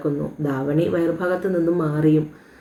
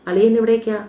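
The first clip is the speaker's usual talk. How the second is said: teasing challenge; 5 semitones higher, 4 dB louder than usual. Acted. Stops friend sneaking after the girl.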